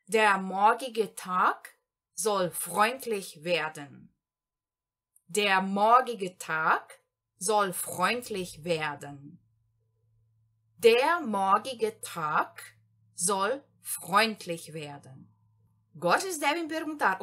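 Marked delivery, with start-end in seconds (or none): 0.1-4.1 slow German example. Shown as a demonstration. Teacher dictates the second example to learners, very slowly. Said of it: "exemplo número dois aqui". 5.3-9.4 slow German sentence. Shown as a demonstration. Teacher reads the whole second example in one go, slowly. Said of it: "exemplo número dois aqui". 10.8-15.3 slow German example. Shown as a demonstration. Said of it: "exemplo número dois aqui".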